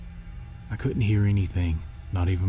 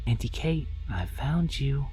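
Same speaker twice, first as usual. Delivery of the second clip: put-on tiny child voice; 6 semitones higher, slower than usual. A narrator's reading. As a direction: whisper it like a creepy little girl. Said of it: "a tiny voice in my ear".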